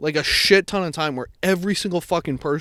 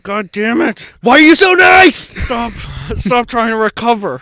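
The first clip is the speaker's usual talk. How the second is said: much higher and louder, laughing mock frustration; exasperated mock outburst; 6 semitones higher, 8 dB louder than usual. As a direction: throw up your hands at her niceness. Exasperated at too-nice worker.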